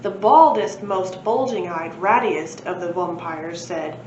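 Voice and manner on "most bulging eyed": plain reading voice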